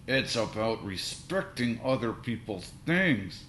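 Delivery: slow, strained words through gritted teeth; through clenched teeth. A narrator's reading. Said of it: "His teeth were clenched".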